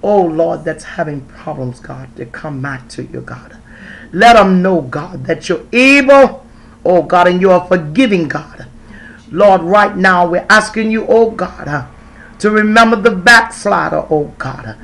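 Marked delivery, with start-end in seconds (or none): none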